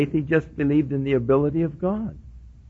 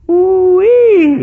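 high, loud whoop of joy; joyful whoop; 10 dB louder than usual. Retold or imitated. Pastor retelling his joy at the news, a high loud whoop. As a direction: whoop it out in delight. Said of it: "Boy, was I happy".

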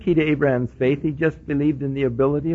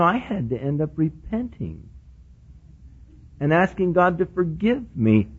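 ordinary talk

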